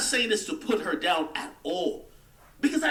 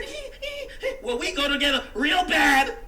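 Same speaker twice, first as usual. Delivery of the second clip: high, giggling mockery of a smitten girl; tittering, lovestruck voice with a laugh; mock giggly girlfriend; 7 semitones higher than usual. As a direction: squeal a silly giggle, then gush it. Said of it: "You said".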